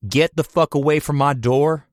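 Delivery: loud, furious yell through the door; angry shout. Acted. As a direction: bark it loud and high. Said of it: "He kicked the door".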